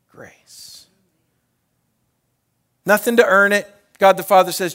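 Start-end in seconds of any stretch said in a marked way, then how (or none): 0.1-0.9 breathy whispered word. Acted. Preacher naming the gift of grace, whispered. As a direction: let the word fall to a whisper. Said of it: "sermon climax word".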